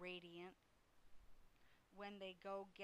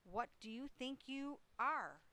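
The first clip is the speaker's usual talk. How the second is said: higher, indignant demand; scolding; 5 semitones higher, 4 dB louder than usual. A narrator's reading. Mother to son playing in the crate, indignant scolding. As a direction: demand an answer of a misbehaving child.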